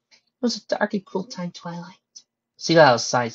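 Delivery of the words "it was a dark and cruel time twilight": high, gentle storytelling character voice; soft-spoken pony. Acted. Pony telling Twilight of Heimdall, high gentle voice. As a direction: tell it softly in a higher voice. Pony answers her friend.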